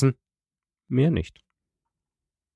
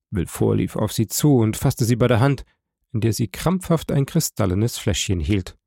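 plain reading voice

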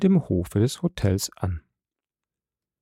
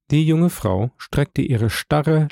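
ordinary delivery, nothing marked

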